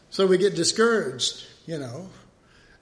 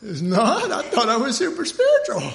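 high, laughing, louder mock dismay; laughing mock surprise; 8 semitones higher, 4 dB louder than usual. Believer to God, laughing high mock disbelief. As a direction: laugh through the disbelief of being told off. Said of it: "we're going".

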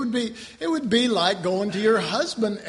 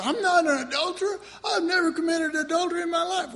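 high, quick protest; mock indignant believer; 10 semitones higher, faster than usual. Preacher mocking a believer who denies adultery, high and huffy. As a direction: play a huffy churchgoer, pitched up.

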